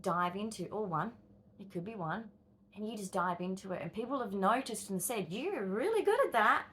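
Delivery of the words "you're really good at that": mock praise. People praising your skill.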